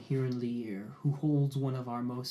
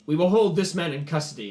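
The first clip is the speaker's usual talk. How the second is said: louder commanding announcement; firm declaration; 4 semitones higher, 8 dB louder than usual. Acted. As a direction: lay down the law to the tribe. Kor declares custody to crowd.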